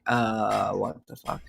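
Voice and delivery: whispered. Retold or imitated. Retelling reaction to food stamps.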